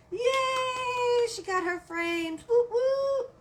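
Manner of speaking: high, loud celebratory whoops; excited cheering. Real, not acted. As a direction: cheer for a friend's good news. Cheering viewer who got frames.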